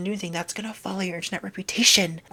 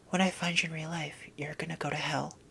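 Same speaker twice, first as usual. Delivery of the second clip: whispered mock threat to trolls; menacing whisper; 2 semitones lower, 9 dB quieter than usual. Real, not acted. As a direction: threaten them in a hush.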